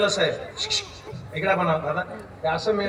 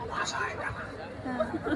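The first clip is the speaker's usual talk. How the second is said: imitating a lady's breathy whisper, "माझं ऐका ना" barely voiced; mock whisper; 8 semitones higher, 9 dB quieter, much slower than usual. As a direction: whisper it the way she did. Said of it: "असा आवाज". (बाईंच्या कुजबुजीची नक्कल)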